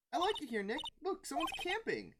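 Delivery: high, cheerful sidekick voice; Maya, cheerful. Acted. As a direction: chirp it to your partner. Voicing Maya in the game.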